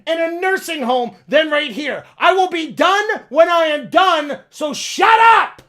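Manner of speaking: angry outburst. Acted. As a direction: blow up at grandpa, building to a shout. Acted quarrel with grandpa.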